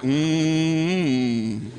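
long humming "mmm"; imitated closed-mouth "mom". Father imitating his son saying mom, a drawn-out hum. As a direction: hum it with lips shut like a mumbling kid. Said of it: "He would say mom like".

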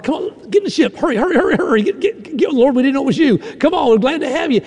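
high, fast, urgent calling out; eager urgent welcome. Retold or imitated. Disciples urging Jesus into the boat, high excited calls. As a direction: hurry him aboard in a rush. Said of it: "I can see him reaching their arms out".

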